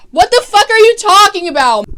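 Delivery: loud, high, disbelieving yell; incredulous shout. Real, not acted. Recapper yelling at the show in disbelief. Recapper's outburst at absurd line.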